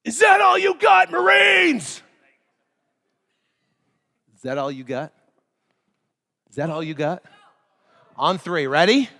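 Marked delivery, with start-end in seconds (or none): 0.0-2.0 high, loud drill instructor bark; drill instructor yell. Retold or imitated. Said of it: "they would turn around and they go". 4.3-7.2 low, softer taunting repeat; quieter drill instructor taunt. Retold or imitated. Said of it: "they would turn around and they go".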